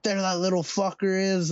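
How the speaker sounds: high, slurred drunk shout, lightly mocked; imitating drunk slurring. Retelling how drunk men at a bus stop spotted him, slurred mock yell. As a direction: mimic a drunk yelling across the road. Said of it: "like, slurring their words".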